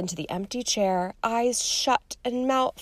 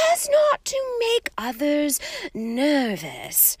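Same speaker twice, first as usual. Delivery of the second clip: misty seer; 5 semitones higher than usual. A narrator's reading. Seer explaining her late arrival, dreamy and airy. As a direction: float the words like a prophecy. Trelawney's lofty voice.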